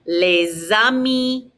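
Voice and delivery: LES AMIS slowly, ending on EE; Z link into AMIS, no S at the end; slow model LES AMIS as lay-za-mee; silent final S. Shown as a demonstration. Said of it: "Don't pronounce the S's on the end".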